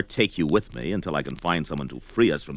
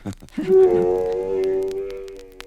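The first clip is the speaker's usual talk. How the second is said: hearty laugh. Acted. Barbarian laughing at trader.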